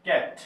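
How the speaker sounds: ক্যাট said alone as English "cat"; example word. Shown as a demonstration. Teacher giving an example word to spell out, ক্যাট said whole. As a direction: model ক্যাট as one separate word. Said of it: "আমি যদি এখন বলি যে".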